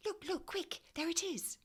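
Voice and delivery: high, breathless "look, look, quick"; child spotting the animal at last; excited urgent call. A narrator's reading. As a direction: point it out in a hurry. Spots the beaver.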